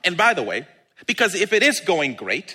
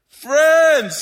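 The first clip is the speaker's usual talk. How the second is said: high, loud, drawn-out hail; calling out; 8 semitones higher, 7 dB louder than usual. Acted. Jesus hailing his disciples from shore, calling out. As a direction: hail friends far off. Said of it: "he called out to them".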